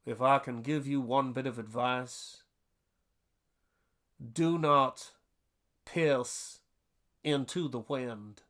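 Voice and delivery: lightly imitated Southern gentleman's accent, slow, with long pauses; a hint of an old Southern dean giving solemn advice, pausing for effect; Dean Bailey's Southern advice. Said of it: "And he said".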